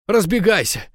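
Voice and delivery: high, loud taunting woman's voice; defiant, scornful high cry; mocking. A narrator's reading. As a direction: throw it back as a high jeer. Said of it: "проговорила и засмеялась".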